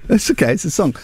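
ordinary delivery

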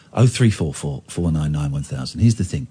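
normal talking voice

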